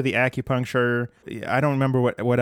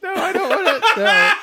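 laughing; 5 dB louder than usual. Real, not acted. Laughing refusal of role play.

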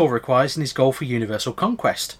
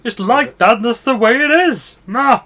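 comic character voice; 9 semitones higher, 5 dB louder than usual. Retold or imitated. Quoting song line in voice.

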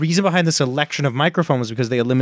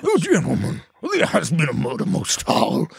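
mystery host voice; 3 semitones higher than usual. Pretend. Murder mystery host role.

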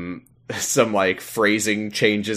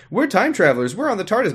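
much higher, dim-witted blurting; silly Leela voice; 10 semitones higher than usual. Retold or imitated. Leela tells the keepers who they are, in a mock high silly voice. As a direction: say it like she thinks it's a clever cover story. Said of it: "like, trying to say".

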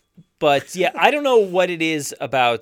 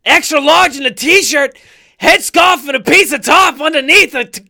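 loud, high, shouted rap lines; 9 semitones higher, 7 dB louder than usual. Retold or imitated. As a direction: shout each bar like a winded rapper. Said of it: "how much you sound like him just doing the yells".

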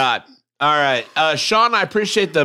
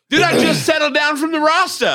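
shouting; 9 semitones higher than usual. Real, not acted. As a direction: yell it out. Excited shout after spicy shot.

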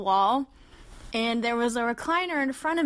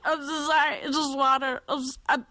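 flustered apology; 4 semitones higher than usual. Retold or imitated. Retold flustered apology after spitting.

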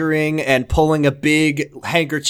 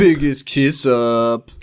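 slow mock award announcer; much slower than usual.

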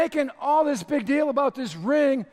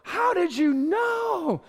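higher, slow, amazed gasp of a question; delighted surprise; 7 semitones higher, much slower than usual. Retold or imitated. Opening a thoughtful gift, slow amazed delight. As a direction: act stunned by a perfect gift. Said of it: "you open it up and you're like".

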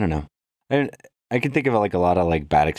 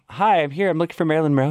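chirpy greeting; 10 semitones higher, faster than usual. Retold or imitated. Cheery self at hotel desk.

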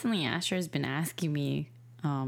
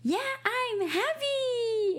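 put-on very high, slow little-kid voice; toddler voice; much slower than usual. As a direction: stretch it out like a proud tiny boy. Mom voices her toddler son.

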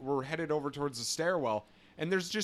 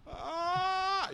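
sudden high yelp of panic; panicked yelp. Retold or imitated. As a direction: yelp in alarm. Retold panic at armed alert.

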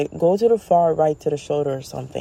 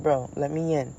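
ordinary talk

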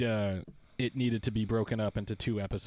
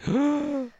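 dramatic gasp; 8 dB louder than usual. Acted. Gasp before grand title.

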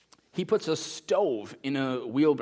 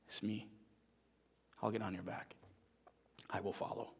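hushed surrender; 3 semitones lower, 14 dB quieter than usual. Acted.

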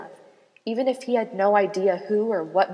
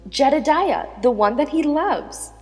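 higher and louder, eager; excited realization; 7 semitones higher, 6 dB louder than usual. Acted. Naomi urging her husband to see the meaning of his name. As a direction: burst out with the discovery.

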